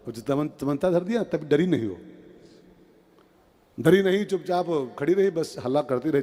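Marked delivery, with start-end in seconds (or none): none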